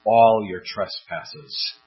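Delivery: All drawn out slow and weighted; All said slow, pressed down for emphasis; stress on all. Shown as a demonstration. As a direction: lean hard on All, slowly. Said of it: "Here the emphasis is on the word all".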